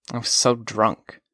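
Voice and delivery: usual speaking voice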